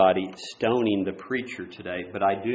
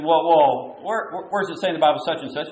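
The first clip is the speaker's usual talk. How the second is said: mock challenging student; 4 semitones higher, 5 dB louder, faster than usual. Quoting a resistant Bible student.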